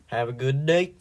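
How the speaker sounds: odd sing-song. Pretend. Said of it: "I don't know why I keep t-, saying it like that".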